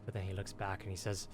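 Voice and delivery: ordinary delivery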